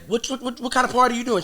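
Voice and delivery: high stammering disbelief; incredulous demand. Retold or imitated. Grilling the woman about her party, high and incredulous. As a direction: pile up the whats in shock.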